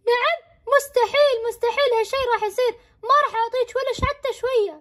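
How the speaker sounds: put-on high, teasing kid voice saying no; taunting little brother; bratty refusal. Brother refusing his sister the strawberry, high and bratty.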